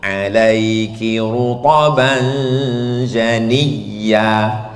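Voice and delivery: slow melodic tartil; slow chanted Quran recitation. Acted. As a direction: recite it long and sung.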